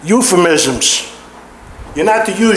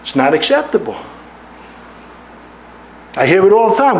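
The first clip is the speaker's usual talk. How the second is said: ordinary delivery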